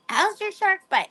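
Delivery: high, sing-song question; imagined friend asking about the shark bite, high-pitched; mock concerned asking. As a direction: ask it up high like a nosy neighbour. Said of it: "Then I might be like".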